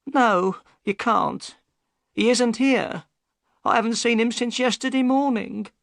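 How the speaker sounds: high, worried woman's voice; the boatman's anxious wife; high worried voice of Mrs Smith; upset wife. Acted.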